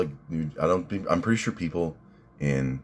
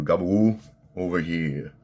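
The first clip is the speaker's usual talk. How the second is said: Silvio Dante; 2 semitones higher than usual. Retold or imitated. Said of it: "Silvio Dante".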